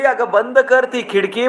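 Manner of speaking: a mother sharply calling her daughter to shut the window. Acted. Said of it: "आई ओरडली".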